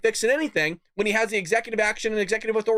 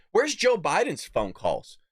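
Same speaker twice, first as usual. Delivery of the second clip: usual speaking voice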